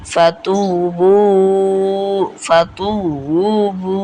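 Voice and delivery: very slow "ফাতুবু" with a long-held "তু"; recited "ফাতুবু", vowels greatly prolonged; three-alif elongation. Shown as a demonstration. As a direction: stretch "তু" and "বু" far out. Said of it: "তিন আলিফ".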